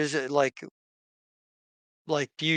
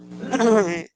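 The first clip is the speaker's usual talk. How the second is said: theatrical throat clear; 7 semitones higher than usual. Acted. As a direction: clear the throat grandly, like a showman. Captain preparing to speak.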